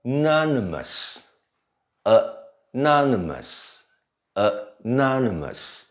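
anonymous slowly, second syllable NON high and long; adjective pronunciation. Shown as a demonstration. Teacher models the adjective form, slow anonymous with stressed NON. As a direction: draw out NON in anonymous, first vowel weak. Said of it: "念法就不太一样".

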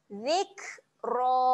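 Zikra with a puff of air on the k; Zikra demo. Shown as a demonstration. Teacher shows students the airy kaf, Zikra. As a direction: release breath on the kaf in Zikra. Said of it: "keluarkan angin sedikit".

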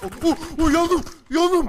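high, loud panicked cries; mock agony. Gamer gets hit and cries to teammate, mock panic. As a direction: cry out as if burning, hammed-up. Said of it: "Abi iyi misin?".

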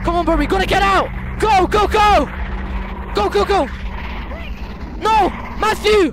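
high urgent shouts, fast; panicked yelling. Real, not acted. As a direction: yell at your friend to run. Fleeing ghost in game.

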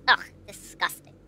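ordinary talk, nothing marked

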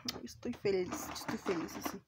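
whisper. Real, not acted. Whispered confession of happiness.

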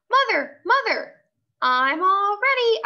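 high, loud eager calling out; excited calling. Acted. Junie B. calling to Mother, high excited call.

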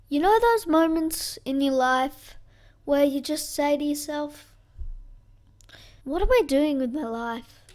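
dramatic. Acted. Dramatic rhetorical aside.

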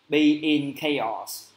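very slow, each word laid out; slow model phrase. Shown as a demonstration. Teacher drills the phrase for students, very slowly. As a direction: spell it out slowly for the class. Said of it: "cụm từ như là be in chaos".

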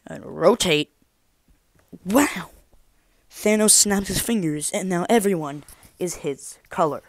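excited reveal. Acted. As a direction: announce the big reveal with a wow. Trick reveal.

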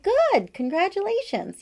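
high, loud burst of delight; excited congratulations. Retold or imitated. Retelling her reaction to a friend's pregnancy news, excited and high.

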